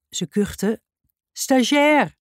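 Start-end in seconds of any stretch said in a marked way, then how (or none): 1.3-2.2 high, called-out interjection; calling out. A narrator's reading. Jackie finishing the teacher's sentence, calling out. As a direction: butt in with a high-pitched call. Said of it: "riep Jackie".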